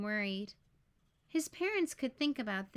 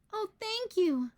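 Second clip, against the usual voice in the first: much higher, louder joyful thank you; a child thrilled to be getting a pet; delighted thanks; 9 semitones higher, 4 dB louder than usual. Acted. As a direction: burst out with happy gratitude. Franklin finally allowed a pet.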